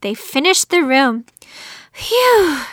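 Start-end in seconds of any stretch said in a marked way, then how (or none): 1.9-2.6 high, falling breath of relief; gasped phew. A narrator's reading. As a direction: let out a long, relieved phew. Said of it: "gasped April".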